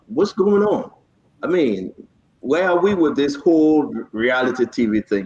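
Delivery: normal talking voice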